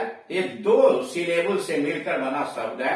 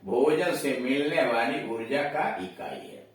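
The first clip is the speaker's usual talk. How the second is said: ordinary delivery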